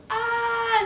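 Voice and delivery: long, high storybook scream; loud cartoon shriek of alarm; play-acted scream. Pig flees the blown-down house, high mock scream.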